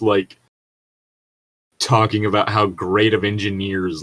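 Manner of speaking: usual speaking voice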